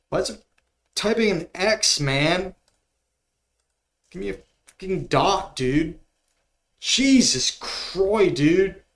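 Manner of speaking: fed up and venting, pitch climbing; real frustration at the keyboard; angry rant. Real, not acted. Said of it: "I'm so mad right now".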